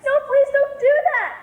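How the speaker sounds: high, loud begging; panicked pleading. Acted. Sister begs not to burn.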